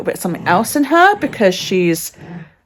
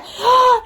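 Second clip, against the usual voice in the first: delighted gasp. Real, not acted. Excited at the dog.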